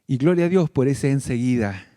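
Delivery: ordinary talk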